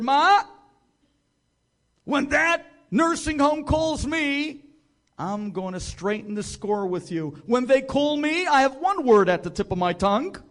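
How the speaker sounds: loud, high, vengeful; spiteful loud retort. Retold or imitated. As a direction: throw it at her, settling the score.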